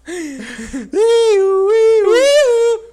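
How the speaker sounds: loud, high, tearful fit of laughter; laughing till crying; real helpless laughing fit; hysterical laugh. Real, not acted. Hysterical laugh at own story.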